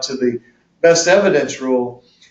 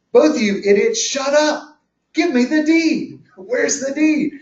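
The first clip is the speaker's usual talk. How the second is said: much higher, slow and fed up, a judge scolding two idiots; exasperated judge; 9 semitones higher than usual. Retold or imitated. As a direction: bark it like an impatient judge demanding the deed. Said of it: "The judge is gonna say".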